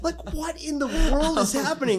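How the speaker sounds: high-pitched, retold disbelief; incredulous outburst. Reacting to friend's lip disease story, incredulous outburst. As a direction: act out being utterly baffled.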